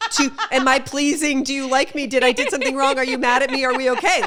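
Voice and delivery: high, loud, frantic pleading questions; mock panicked people-pleaser; anxious people-pleaser. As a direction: beg for reassurance, fretting and breathless. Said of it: "I'm just like".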